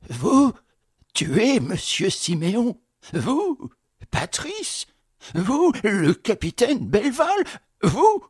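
montées très aiguës sur chaque "Vous"; répète, sidéré; effaré. Acted. Concierge effaré. (le concierge sidéré questionne Patrice, très aigu)

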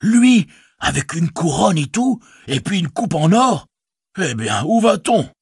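a worried village innkeeper speaking up; innkeeper Butterbur. Acted.

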